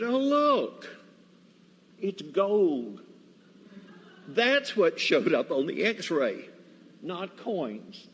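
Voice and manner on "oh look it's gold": mock surprise. Soldiers find Bibles on x-ray.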